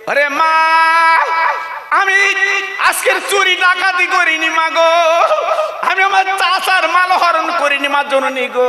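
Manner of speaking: a son shouting his innocence to his mother; crying shout. Acted. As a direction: cry out your innocence. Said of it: "ছেলে কেঁদে কেঁদে বলছে".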